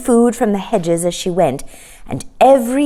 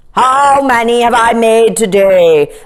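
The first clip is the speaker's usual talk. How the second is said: king's voice; 4 semitones higher, 6 dB louder than usual. A narrator's reading. King asks secretary.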